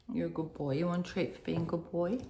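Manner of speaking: soft coaxing pet talk; talking to a dog. Real, not acted. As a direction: softly praise the dog. Talking to her dog Teddy.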